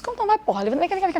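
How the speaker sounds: high-pitched mock coaxing; retold rallying. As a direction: act out urging someone onto their feet. Retold rallying of struggling person. (incentivo encenado, em tom agudo)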